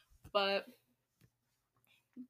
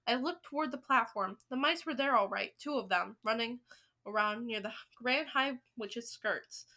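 usual speaking voice

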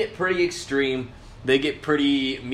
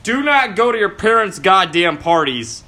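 louder, higher, slow angry warning; 5 semitones higher, 8 dB louder, slower than usual. Real, not acted. Scolding viewers about parents' parties, angry and loud. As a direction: hammer the warning home, furious. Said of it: "I'm fucking pissed".

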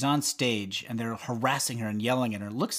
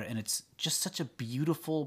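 ordinary talk, nothing marked

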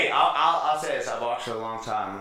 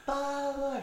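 mocking girlfriend babble; 12 semitones higher, 5 dB quieter than usual. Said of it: "his girl's like".

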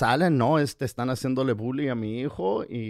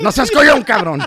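much higher and louder, a hammed-up yell at the boy; a guest jumps in as the father, yelling at the scared son; mock father shout; 8 semitones higher, 12 dB louder than usual. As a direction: shout it like the furious dad egging him on. Guest voices the angry father.

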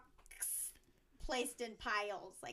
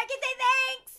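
loud, high mock shout; playful fleeing-date yell; calling back thanks; 12 dB louder than usual. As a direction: holler it back over your shoulder. Acts out calling back while fleeing.

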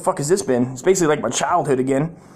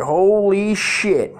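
higher, long drawn-out "holy shit"; stunned exclamation; 6 semitones higher, 3 dB louder, much slower than usual. Retold or imitated. Wife seeing the chapped backside, acted stunned and drawn-out. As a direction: retell her shock, stretching the words out.

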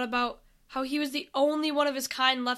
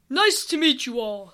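lightly voiced commander, louder; army leader; 5 dB louder than usual. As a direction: greet them in a mildly booming voice. Army leader greets the group.

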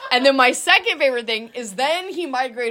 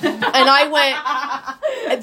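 normal talking voice

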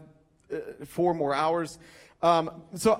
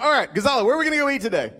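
eager question; 5 semitones higher, 5 dB louder, much faster than usual. Retold or imitated. Asks friend, retold, eagerly.

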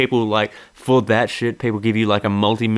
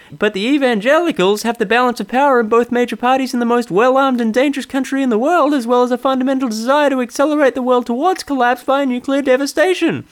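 mock Seinfeld's cadence; hammed-up Seinfeld parody; Jerry Seinfeld impression; 13 semitones higher than usual. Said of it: "my pseudo Jerry".

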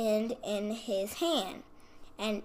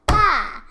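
high, explosive "pah"; sudden loud burst for a slap; loud slap noise; 9 semitones higher, 15 dB louder than usual. A narrator's reading. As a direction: smack it out, pitched way up. Fairy's second slap, sounded out.

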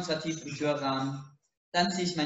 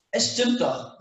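raised, insistent protest; 8 semitones higher, 4 dB louder, slower than usual. A narrator's reading. Roommate protesting to the narrator, louder and insistent. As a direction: push back hard on the claim. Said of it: "protestiert Sassy".

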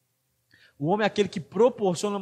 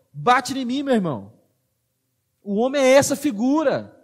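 louder, impassioned sermon delivery; fiery preaching; 4 semitones higher, 4 dB louder than usual. Acted. Pastor urging the men of the church, preaching at full force. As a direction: drive every line home to the congregation.

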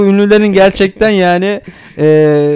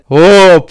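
inner voice exclamation; 6 dB louder than usual. Retold or imitated. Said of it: "kendi iç sesi şey yapıyor".

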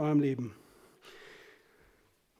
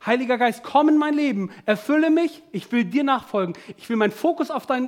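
raised, louder praying voice; impassioned plea from the pulpit; fervent prayer; 4 semitones higher, 5 dB louder than usual. Acted. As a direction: pray urgently to the Holy Spirit.

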